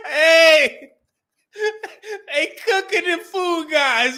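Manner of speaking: loud laughter spilling into the words; cracking up, barely getting it out; real loud laugh over the line; laughing hard. Real, not acted. Cracking up at the comment.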